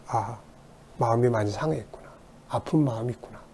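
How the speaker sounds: soft, soothing understanding; gentle empathy. Shown as a demonstration. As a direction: comfort a hurt child quietly. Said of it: "이해하는 거죠".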